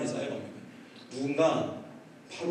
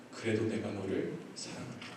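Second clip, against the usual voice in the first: low, soft, tender assurance; voice of God; 6 semitones lower, 9 dB quieter than usual. Retold or imitated. God forgives the repentant pastor, low and gentle. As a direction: quietly reassure as God would. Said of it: "하나님께서 저에게 한 번 더 말씀해".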